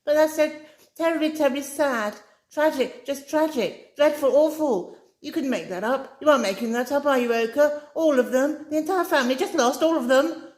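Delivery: high, loud, breathless dismay; a young woman overwhelmed by the tragedy; Amy, distraught. A narrator's reading. Amy laments the lost family, high and upset. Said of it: "Amy asked breathlessly".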